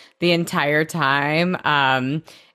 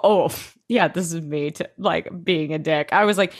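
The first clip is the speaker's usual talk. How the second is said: ordinary delivery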